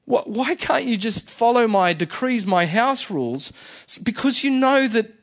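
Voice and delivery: emotional, high-pitched plea, dropping at the end; God. Acted. God begging his people to obey out of love, emotional. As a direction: plead like a hurt, exasperated parent. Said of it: "was that God getting emotional".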